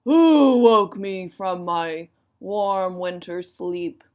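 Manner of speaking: loud, slow bear's snarl. A narrator's reading. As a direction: drag it out as a loud, grumpy bear. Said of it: "he snarled".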